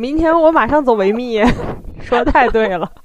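loud, high, gleeful echo; excited. Retold or imitated. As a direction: shout it back with glee. Excited echo of friend's joke.

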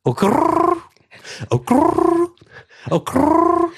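long high trilled r in 咯儿; rolled r. Shown as a demonstration. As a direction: roll the r of 咯儿 hard and high. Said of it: "可是你会弹舌了耶".

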